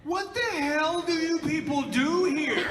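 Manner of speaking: high, loud, drawn-out mock horror; hammed-up appalled cry, slow and high; horrified outburst. Traumatised engineer reacting to the scene, high horrified outburst.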